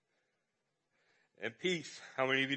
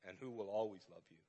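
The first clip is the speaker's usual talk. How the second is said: tender; 4 semitones lower, 6 dB quieter than usual. Retold or imitated. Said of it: "And I'd say".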